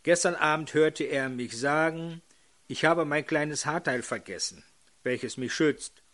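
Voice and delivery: ordinary talk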